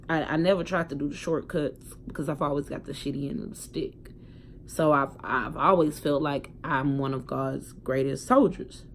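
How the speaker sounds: ordinary delivery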